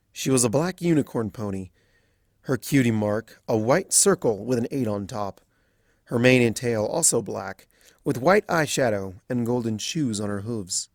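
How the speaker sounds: ordinary delivery, nothing marked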